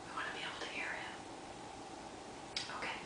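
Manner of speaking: fast, hushed whisper. Shown as a demonstration. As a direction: hush the children so they listen. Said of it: "Okay, shh".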